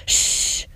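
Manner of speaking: mother's church shush; long voiceless shush for quiet; hushing shh. Retold or imitated. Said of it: "Be quiet and pay attention".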